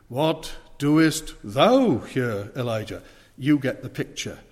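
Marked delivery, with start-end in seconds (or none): 0.1-3.0 "thou" stretched very slowly and loudly, falling; stress on THOU. Shown as a demonstration. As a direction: drag out "What doest thou here", peaking on "thou". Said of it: "emphasizing each particular word".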